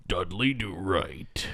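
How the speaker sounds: soft, slow mock-narrator title read; cartoon narrator impression. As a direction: stretch the name out like a narrator. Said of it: "every time he's like".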